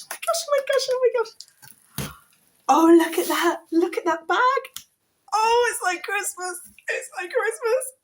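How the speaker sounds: high, loud gushing squeals; thrilled shrieking; overjoyed squeal. Real, not acted. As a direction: squeal with delight at the gift. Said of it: "I think I might cry".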